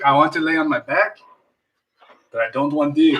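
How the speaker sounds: a light mock Russian accent as Hitler; lightly hammed-up Russian accent, played for laughs. Friends joking about Hitler, in a light mock Russian accent. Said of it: "doing a Russian accent for Hitler".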